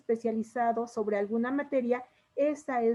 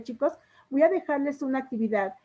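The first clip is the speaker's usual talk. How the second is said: ordinary talk, nothing marked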